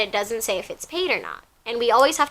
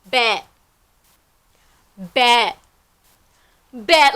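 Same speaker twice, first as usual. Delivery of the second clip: flat, dismissive repeated word; mild mock indifference; nonchalant bet; much slower than usual. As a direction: shrug it off coolly. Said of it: "You know what he says?".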